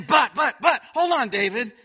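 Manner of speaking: loud, high "but, but" stopping himself short; catching himself. Acted. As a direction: halt yourself mid-spiral, loud and urgent. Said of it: "he grabs hold of himself".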